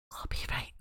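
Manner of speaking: шёпотом «copyright»; выдохнуть слово шёпотом, как секрет; whispered aside. Real, not acted.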